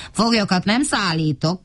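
ordinary delivery, nothing marked

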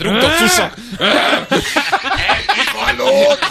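very high voice breaking into laughter; mock hungry elephant. Host voices a noisy elephant wanting food, laughing mock demand. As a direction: play the demanding animal, laughing.

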